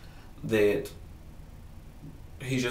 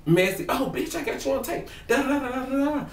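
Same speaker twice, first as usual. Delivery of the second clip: gleeful mock taunt; 12 semitones higher than usual. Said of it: "So she's like".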